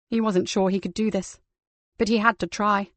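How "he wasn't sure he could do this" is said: ordinary delivery, nothing marked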